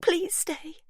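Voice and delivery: high, cracking plea; voice breaking. Acted. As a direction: let it crack as she begs. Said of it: "her voice breaking".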